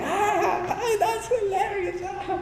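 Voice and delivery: high squealing laugh through the words; acted laughing. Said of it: "la persona está así riéndose".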